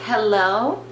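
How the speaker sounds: ordinary delivery, nothing marked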